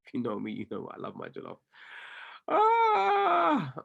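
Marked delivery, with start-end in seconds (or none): 2.5-3.7 high, piercing pretend wail; mock anguished cry. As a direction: cry out as if the jollof is lost. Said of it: "Not the jollof".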